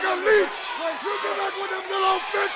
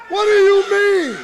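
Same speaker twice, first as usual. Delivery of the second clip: indignant shout; 7 dB louder than usual. Acted. Wrestler's indignant promo.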